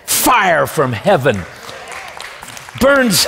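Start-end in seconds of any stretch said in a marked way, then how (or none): none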